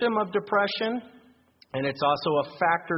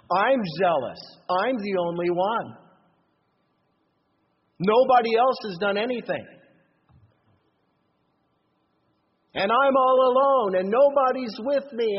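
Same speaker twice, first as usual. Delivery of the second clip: a hint of a self-righteous boast, louder and higher toward the end; 5 semitones higher, 5 dB louder than usual. Preacher voicing Elijah's self-pity, mildly self-righteous. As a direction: puff up, mildly indignant. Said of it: "He has a self-righteous spirit".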